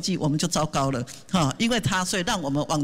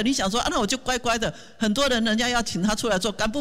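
ordinary delivery

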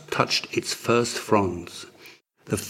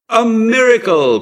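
much higher, louder joyful shout; excited cry; 13 semitones higher, 9 dB louder than usual. A narrator's reading. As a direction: burst in shouting the good news. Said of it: "cried the arrival".

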